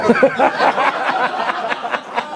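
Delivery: high laugh. Real, not acted. Brother telling the guests, laughing at the story. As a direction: break into laughter.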